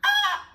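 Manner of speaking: sudden high yelp of surprise; a sharp startled ah; startled shriek. Real, not acted. Caught off guard while baking, a high startled shriek.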